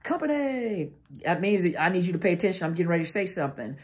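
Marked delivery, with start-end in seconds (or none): 0.0-0.9 high, drawn-out call falling away; military preparatory command. Shown as a demonstration. Speaker showing troops the call for attention, military command call. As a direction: stretch it out like a drill command. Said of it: "the inflections of the voice let you know".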